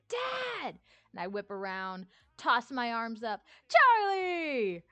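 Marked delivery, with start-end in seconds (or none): 0.1-0.8 high excited call of Dad; imitated kid calling. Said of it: "I'd just hear". 3.7-4.8 big loud high cry of Charlie; shouting back to a fan in character; loud excited call. Retold or imitated. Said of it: "Just, like, real loudly".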